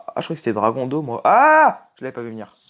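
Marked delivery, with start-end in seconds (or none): 1.2-1.9 loud high startled yelp; startled cry. Real, not acted. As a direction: cry out caught off guard. Sudden ambush in game.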